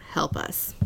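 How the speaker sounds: soft unvoiced whisper; hushed whisper of a hidden note. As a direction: whisper it like a secret plea. Reading a desperate note.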